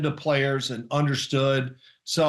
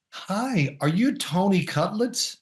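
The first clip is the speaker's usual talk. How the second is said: lightly voiced wife, a bit higher and bright-eyed; imitating his wife; 5 semitones higher, slower than usual. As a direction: play the wife meeting a star, innocent. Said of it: "She goes over to him and says".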